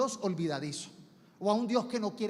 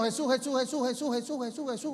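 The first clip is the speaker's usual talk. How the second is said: mimicking repetitive prayer; 4 semitones higher, faster than usual. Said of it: "eso está repitiendo".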